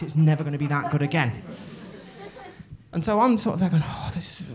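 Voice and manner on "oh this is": awed whisper. Retold or imitated. Overwhelmed on set.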